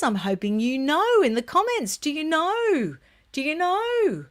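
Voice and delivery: high, teasing plea; playful coaxing. Real, not acted. Vlogger asking viewers who the handsome guy is, playful coaxing. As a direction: coax an answer out of them, voice lifting high.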